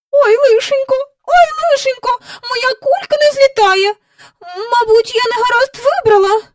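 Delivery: high, loud wailing character voice; distressed lament. A narrator's reading. Woman whose balloon won't rise, lamenting loudly. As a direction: wail it in high-pitched dismay.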